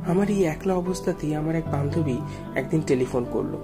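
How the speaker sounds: ordinary delivery, nothing marked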